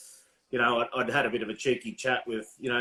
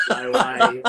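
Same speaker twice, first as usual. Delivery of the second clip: loud, high laughing no way; higher, louder laughing refusal; 8 semitones higher, 8 dB louder than usual. Retold or imitated. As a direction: blurt it out with a laugh. Refusing the choke-hold offer.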